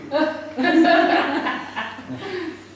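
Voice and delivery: high, long burst of laughter. Real, not acted. Preacher laughing at yet another bill, long high laugh.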